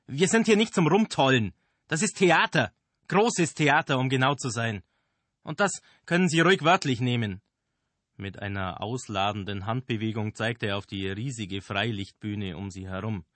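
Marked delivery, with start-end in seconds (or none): 0.1-7.4 high, loud theatrical rant; ranting director. Acted. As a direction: lecture them grandly and loudly. Director rants at the actors.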